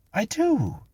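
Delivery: very high, indignant reply; defensive protest. A narrator's reading. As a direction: insist in a high voice. Clara protests to policeman.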